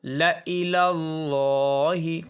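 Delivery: very slow, drawn-out recitation of ला इलल्लाहि; Quranic recitation. Shown as a demonstration. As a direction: chant it slowly, alif silent. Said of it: "ये अलिफ silent है".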